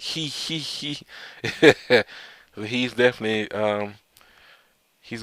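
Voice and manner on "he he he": higher mock evil giggle; villain cackle. Doflamingo gloating on his way, higher evil cackle. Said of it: "He's like".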